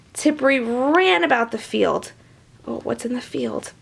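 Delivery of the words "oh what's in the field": usual speaking voice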